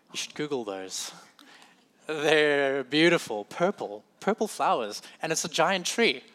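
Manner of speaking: normal talking voice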